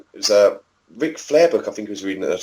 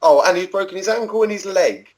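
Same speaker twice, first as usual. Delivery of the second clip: imitated Welsh accent, climbing higher; sing-song mock Welsh, pitched up by the end; Welsh nurse; 4 semitones higher than usual. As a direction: put on an apologetic Welsh nurse on the phone. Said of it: "from a fucking Welsh nurse".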